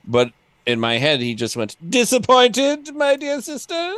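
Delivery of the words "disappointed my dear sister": much higher, slow, silky-smug wizard; imagined Raistlin voice. Retold or imitated. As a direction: purr the taunt slowly at his sister, way up high. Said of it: "this is not his voice, of course".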